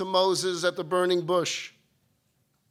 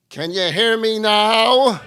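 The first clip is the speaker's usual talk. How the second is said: slow, much louder booming call; booming God voice; 12 dB louder, slower than usual. Acted. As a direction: boom it out like God from above. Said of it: "calls out to him in a booming voice".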